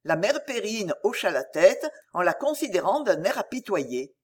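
plain reading voice

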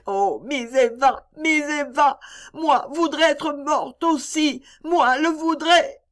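put-on high, slow, heartbroken child's wail; a little girl crying for her lost friend. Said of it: "un accent si déchirant".

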